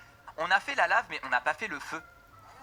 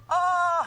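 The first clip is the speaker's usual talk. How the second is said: loud, high "oh" of shock; shocked shout; 13 semitones higher, 8 dB louder than usual. Real, not acted. Shocked by the character's face. (grand cri de stupeur aigu)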